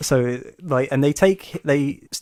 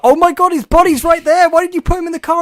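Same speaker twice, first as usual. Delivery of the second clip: much higher, louder mock panic; funny improv panic; 9 dB louder than usual. Retelling the characters finding the body, high mock panic. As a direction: shriek it like a panicked friend. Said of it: "back into the funny improv thing".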